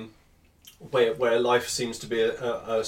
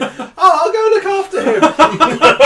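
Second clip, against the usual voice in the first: put-on high, slow, laughing little-boy voice; toddler voice; 12 dB louder, much slower than usual. Father laughing as he imitates his son offering to help. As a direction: play the eager toddler, giggling through it. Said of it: "And Vinny going".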